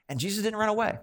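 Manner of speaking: ordinary talk, nothing marked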